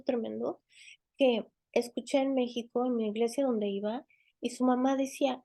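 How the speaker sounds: ordinary talk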